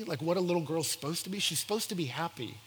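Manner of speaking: ordinary talk, nothing marked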